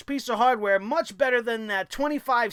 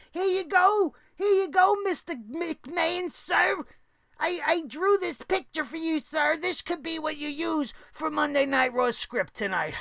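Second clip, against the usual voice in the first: pre-K teacher; 5 semitones higher than usual. Acted. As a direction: timidly offer up a childish drawing. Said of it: "like the pre-K teacher".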